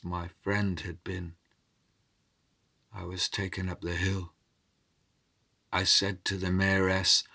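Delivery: measured storyteller voice, dramatic rises and hushes; story narration. A narrator's reading. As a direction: tell the tale to a listening crowd. Storyteller narrating a folk tale.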